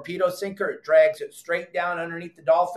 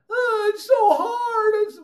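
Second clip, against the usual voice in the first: very high, slow whimper; mock whining weakling; 4 dB louder, slower than usual. Mocking weak clients who can't bend it. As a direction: whine like someone who can't bend the rod. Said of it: "most people cannot bend the rod".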